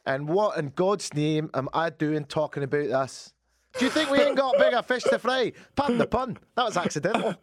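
high, worked-up Scottish rant; comic Scottish outburst, rising to a shout; exasperated Scottish superintendent. Acted. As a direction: explode in a put-on Scottish accent. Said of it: "he said".

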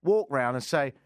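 ordinary delivery

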